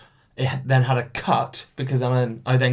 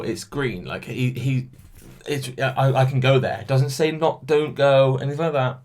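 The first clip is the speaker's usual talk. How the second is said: ordinary talk, nothing marked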